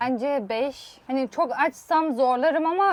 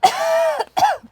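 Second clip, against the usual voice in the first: loud, high spluttering cough; choking cough; 8 dB louder than usual. Real, not acted. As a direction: cough hard on chili. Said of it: "Acı boğazıma kaçtı".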